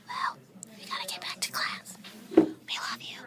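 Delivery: soft, low whispered sign-off; hushed farewell during class; whispering. Real, not acted. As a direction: whisper goodbye to the viewers.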